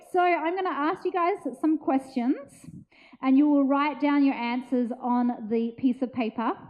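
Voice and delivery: ordinary talk, nothing marked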